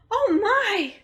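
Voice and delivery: high, surprised oh my; mock dismay; sudden realization. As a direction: catch yourself forgetting. Narrator remembers forgotten pie.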